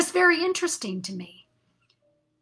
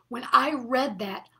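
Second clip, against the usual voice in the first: normal talking voice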